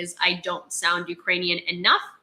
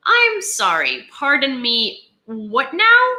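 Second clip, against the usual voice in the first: slow, high and louder disbelief; incredulous; 5 semitones higher, 5 dB louder, slower than usual. Real, not acted. Reacting to news on German weapons, incredulous. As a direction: stop short as if you misheard.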